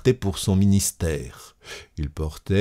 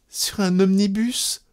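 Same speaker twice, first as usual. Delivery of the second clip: high, small woman's voice; tearful wife; 13 semitones higher than usual. A narrator's reading. Wife answering her cousin's question, tearful.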